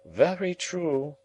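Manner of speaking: slow, high, emphatic agreement; solemnly drawn-out assent in a high voice; grave and emphatic. A narrator's reading. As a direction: agree slowly, weighting each word. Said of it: "said gravely and emphatically".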